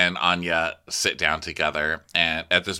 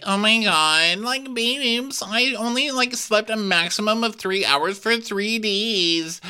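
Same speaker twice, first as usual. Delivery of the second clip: much higher, slow whine; high whiny babe voice; 13 semitones higher, slower than usual. Retold or imitated. Daughter complaining to mom, high whiny voice. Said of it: "Victoria's like".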